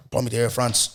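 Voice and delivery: normal talking voice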